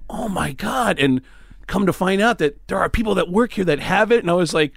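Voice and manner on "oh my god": awed gasp. Retold or imitated.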